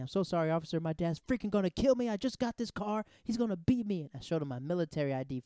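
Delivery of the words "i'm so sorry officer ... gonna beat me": loud, high-pitched mock panic; acting panicked. Young driver begging the officer about his dad, acting panicked. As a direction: ham up the terror, pitch climbing as you plead. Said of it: "I just start acting".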